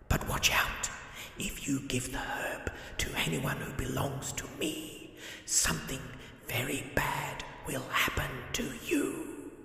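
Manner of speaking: Death. Acted. Death voiced, long speech.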